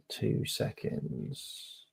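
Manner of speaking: breathy whisper, slow and hushed; whispered aside. Real, not acted. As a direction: drop to a whisper while you check something. Streamer steps away mid-show.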